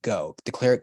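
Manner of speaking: ordinary talk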